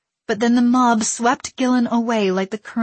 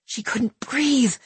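plain reading voice

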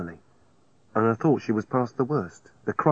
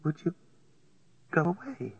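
quiet; 6 semitones higher, 7 dB quieter than usual. A narrator's reading. Dying woman asks quietly.

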